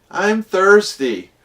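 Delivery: put-on high, slow whiny child voice; a thirsty little kid whining to Dad. Kid whining to Dad on the desert trek, high and slow. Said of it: "one of the little kids says".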